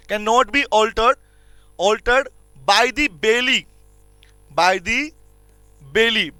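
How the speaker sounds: very slow, phrase by phrase with long pauses; feed it to them word by word; slow dictation. Shown as a demonstration. Teacher dictating the rule for students to write down. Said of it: "लिखो".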